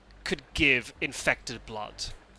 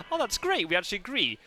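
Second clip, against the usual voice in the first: mock delighted Brits and Americans; 7 semitones higher than usual. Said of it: "go like".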